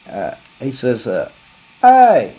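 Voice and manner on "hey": loud high call of hey; shouted hey. Retold or imitated. Shoe clerk yelling to his boss. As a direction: call out to get attention. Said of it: "or something like that".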